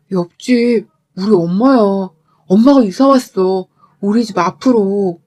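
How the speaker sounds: lightly voiced husband making a slow confession; slow, drawn-out reluctant admission; uneasy husband. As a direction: own up slowly, a touch uneasy. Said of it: "혼자 안절부절 못해하는".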